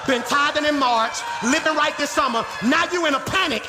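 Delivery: high, driving sermon shout; impassioned preacher building in punched phrases; full-force preaching. Real, not acted. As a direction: drive each phrase home to the congregation.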